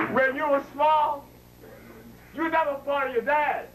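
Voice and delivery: ordinary delivery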